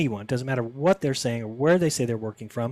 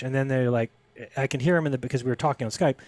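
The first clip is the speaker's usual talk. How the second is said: ordinary talk, nothing marked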